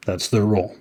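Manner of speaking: ordinary talk, nothing marked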